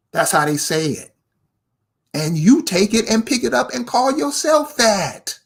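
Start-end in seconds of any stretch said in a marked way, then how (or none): none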